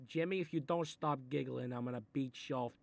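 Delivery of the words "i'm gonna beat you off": ordinary talk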